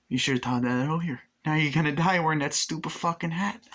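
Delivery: mock taunt. Taunting dying golfer.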